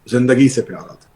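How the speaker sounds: ordinary talk, nothing marked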